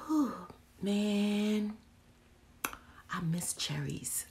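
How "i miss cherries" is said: usual speaking voice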